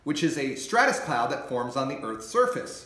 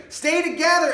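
high loud shout over wind; 14 semitones higher, 8 dB louder than usual. Acted. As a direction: call out above the storm. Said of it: "Alice shouted".

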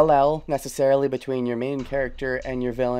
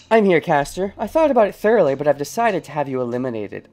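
haughty; 3 semitones higher, 6 dB louder than usual. Retold or imitated. Translating Rin's haughty game line.

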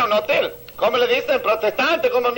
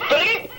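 imitated phone ring; 6 semitones higher than usual. Joke, phone rings.

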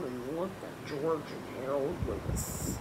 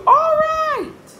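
very high, loud giving-in; exasperated shout; 17 dB louder than usual. Acted. George yelled at by his double, shouting back.

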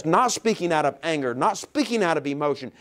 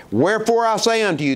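louder, proclaiming scripture; scripture reading; 3 semitones higher, 7 dB louder than usual. Acted. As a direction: declare it like Jesus speaking. Reads Jesus' words aloud.

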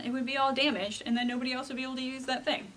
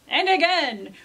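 raised, louder repeat; excited narration; 4 semitones higher, 8 dB louder than usual. A narrator's reading. Llama presses the button again, excited storyteller. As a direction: punch it up as the action builds.

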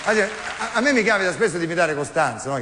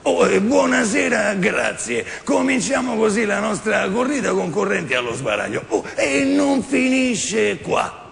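slow guttural TV host greeting the audience; Corrado; 4 semitones higher, slower than usual. Retold or imitated. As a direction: boom the welcome like a variety host with throaty grunts.

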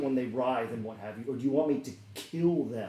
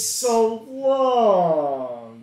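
higher, louder, slow stretched delight; delighted villainess greeting; 4 semitones higher, 8 dB louder, much slower than usual. Acted. Jinx spotting old friend Milo, slow theatrical delight. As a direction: savor every word of the reunion.

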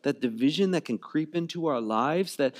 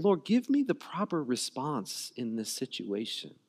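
quiet prayer; 4 semitones lower, 6 dB quieter than usual. Pretend. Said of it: "be praying".

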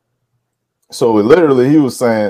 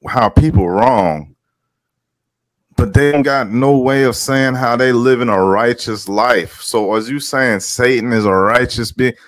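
ordinary delivery

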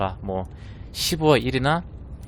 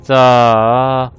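loud, slow, drawn-out count; dramatic countdown; 9 dB louder, much slower than usual. Real, not acted. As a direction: build the suspense. Countdown before reveal.

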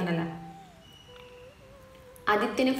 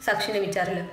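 ordinary delivery, nothing marked

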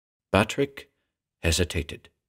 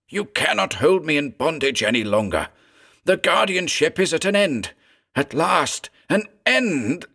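defiant cry; 8 semitones higher than usual. A narrator's reading. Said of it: "His final words choked off in a sob".

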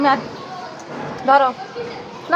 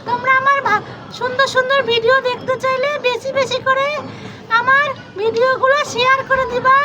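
higher, sweet little character chatting to viewers; cute toy vlogger voice; 8 semitones higher than usual. Acted. Toy character asks viewers to share her videos, sweetly. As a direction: play a tiny vlogger begging for likes.

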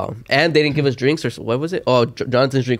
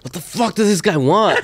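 pissed; 5 semitones higher than usual. Retold or imitated. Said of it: "Johnny's fucking pissed".

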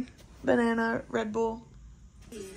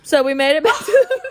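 laughing; 10 semitones higher, 10 dB louder than usual. Real, not acted. Laughing back at the hotel.

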